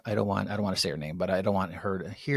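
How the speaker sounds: fast, soft secretive rush; hushed hurried. Retold or imitated. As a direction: rush it out so she can't hear. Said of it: "And he's like".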